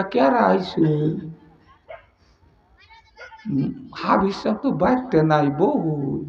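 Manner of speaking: soft, lilting housewife answering a caller; hushed, honeyed imitation of a wife; coy woman's voice. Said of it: "মেয়ে মানুষের টোনটা একটু মায়াবী".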